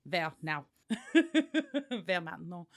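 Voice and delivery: put-on laughing through a goofy French accent; a silly French accent, cracking up. Playing a French-speaking Viking, laughing goofy French accent. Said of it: "just this goofy little French accent".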